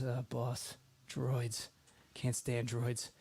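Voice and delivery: low, soft gruff grumble; lightly voiced gruff guard; grumpy bodyguard. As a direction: mutter it like a bodyguard who hates droids. Bert voiced, grumbling about droids.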